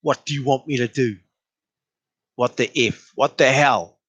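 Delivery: usual speaking voice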